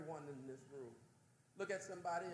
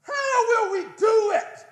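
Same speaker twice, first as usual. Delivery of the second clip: loud, higher, near-crying shout; fired-up cry; 22 dB louder than usual. Real, not acted. Preacher pleading with the congregation in a loud cry. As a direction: cry it out with fervour.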